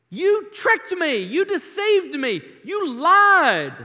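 loud, slow, rising outrage; anguished accusation. Acted. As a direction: cry it out as if betrayed. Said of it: "Just listen to this".

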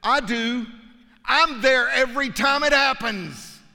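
a lightly voiced God who knows it all; triumphant God; proud God. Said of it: "God says".

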